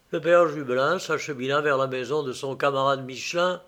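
ordinary delivery

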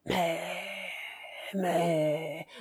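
low, quiet, drawn-out "mais"; hesitant. Acted. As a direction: trail on "mais", searching for a way out. Count hesitates before consoling himself. (hésitant, voix basse qui traîne)

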